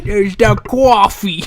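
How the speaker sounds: slow, hammed-up New York drawl; mock New York accent, dragging it out. Quoting the wrestler's promo in mock New York accent. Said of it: "a New York accent".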